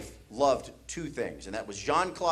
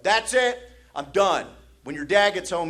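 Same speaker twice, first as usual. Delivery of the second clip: fed-up mom; 5 semitones higher, 5 dB louder than usual. Retold or imitated. Mom scolding, acted quote.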